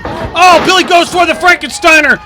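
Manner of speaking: announcer erupting as the move hits; excited call. Acted. Wrestling commentator to viewers, excited rising call.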